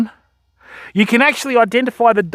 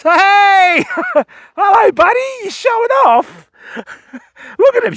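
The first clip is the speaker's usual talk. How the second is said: excited greeting; 12 semitones higher than usual. Real, not acted. Dolphin surfaces right by kayak.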